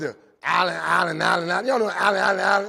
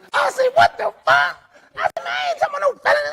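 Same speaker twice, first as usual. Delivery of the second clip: much higher, laughing squeal of disbelief; squealing outrage; 4 dB louder than usual. Retold or imitated. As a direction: shriek in comic outrage. Indignant squeal at lawyer question.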